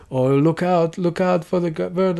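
put-on rehearsed excitement; fake gamer chat. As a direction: lightly imitate scripted gamers warning each other. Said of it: "do this fake gamer chat".

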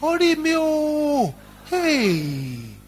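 high, drawn-out cry of alarm; the father suddenly remembers and cries out. Acted. Father remembers the bottle charm.

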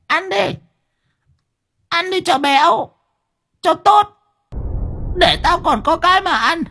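very high, screechy taunt; menacing old grandmother. A narrator's reading. Grandmother forcing rice on the girl, shrill menace.

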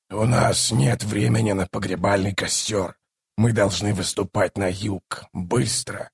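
low, restrained command; low, softened sorcerer voice; grave bone caster giving orders. Acted. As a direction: order them quietly in a low voice.

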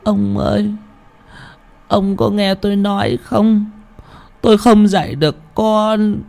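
put-on high, quavering tearful plea in an old woman's voice; sobbing old woman. Widow sobbing to her husband's ghost, high tearful old voice. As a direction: plead through tears, then vow firmly. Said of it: "Bà Bình nức nở".

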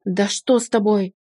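cross, forceful demand; a mild angry growl. A narrator's reading. Husband grabbing wife's shoulders, growling in frustration. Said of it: "рыкнул он".

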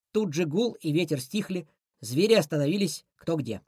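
plain reading voice

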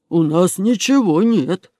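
slow, drawn-out crook voice; denying. Acted. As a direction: protest innocence with stretched words. Caught robber denies having paintings.

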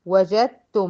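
tajweed demo; recited merge. Shown as a demonstration. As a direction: say وجدتم with no د heard. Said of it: "من الجيم على الت المشددة".

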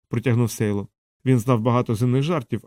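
usual speaking voice

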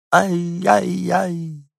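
very high, very slow whine; little dog whining from the balcony, drawn out; distant dog wail. A narrator's reading. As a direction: stretch the whine out long.

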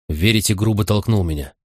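plain reading voice

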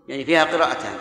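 normal talking voice

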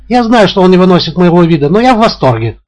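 high, loud and out of control; hysterical shout. A narrator's reading. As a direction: keep screaming it, almost shrieking. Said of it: "истерически кричит она". (высокий громкий истерический крик)

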